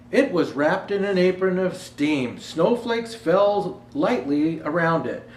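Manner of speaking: put-on storybook reading for children; gentle read-aloud to kids; story narration. Reads picture book aloud.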